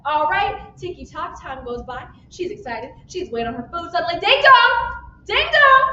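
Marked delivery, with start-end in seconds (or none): 4.2-5.8 high, loud ding-dong; loud sung two-note chime; doorbell imitation. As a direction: call out like a doorbell.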